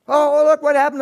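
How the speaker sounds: loud, high, bursting with relief; excited relief. Retold or imitated. Waking up freed from depression, loud and high. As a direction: blurt it out with sudden joy.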